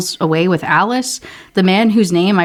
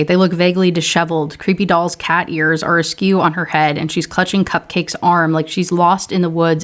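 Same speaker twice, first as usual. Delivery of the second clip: ordinary delivery, nothing marked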